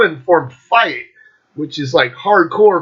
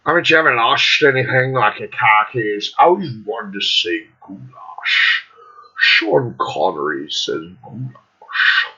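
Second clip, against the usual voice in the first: Sean Connery; 7 semitones lower, 3 dB quieter than usual. Acted. Vlogger slipping from Australian into Scottish, low Connery-like voice. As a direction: go low and slushy on the "sh", Scottish-style. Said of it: "end up talking like Sean Connery".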